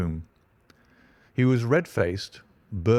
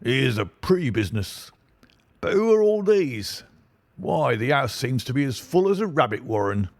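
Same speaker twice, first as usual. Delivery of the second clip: blustering inspector; 4 semitones higher than usual. Acted. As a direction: bluster like a self-important detective.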